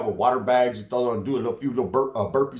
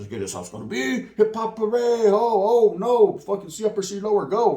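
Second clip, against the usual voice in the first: high excited yard chatter; pumped to go fight; hyped-up inmates; 6 semitones higher, 3 dB louder than usual. Retold or imitated.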